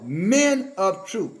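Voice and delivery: "men" drawn out slowly and leaned on; heavy, slow emphasis on "men"; stress on men. Real, not acted. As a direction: hit the word "men", stretching it out. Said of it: "I can't emphasize this word".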